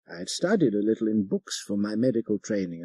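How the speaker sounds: ordinary delivery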